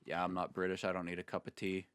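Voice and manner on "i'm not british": usual speaking voice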